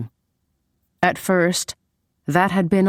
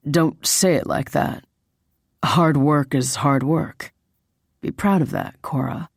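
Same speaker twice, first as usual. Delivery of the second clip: lightly voiced reassuring boyfriend. Man praising his girlfriend's hard work, lightly voiced. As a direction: encourage her, easing off on the name.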